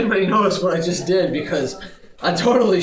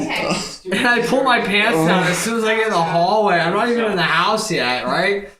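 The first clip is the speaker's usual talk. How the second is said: ordinary talk, nothing marked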